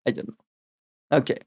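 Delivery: ordinary talk, nothing marked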